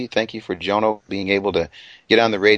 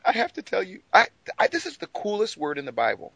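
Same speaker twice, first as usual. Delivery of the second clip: ordinary delivery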